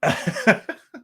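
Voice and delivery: high giggle at own joke; corny dad laugh. Real, not acted. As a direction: crack up at your own joke. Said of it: "I'm laughing at my own jokes".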